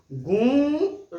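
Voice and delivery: गूं held very long with an open, ringing nasal ऊं; गूं drawn out, mouth rounded but open; slow nasal vowel demo. Shown as a demonstration. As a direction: stretch गूं slowly through mouth and nose. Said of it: "देखिए मुंह खुली है".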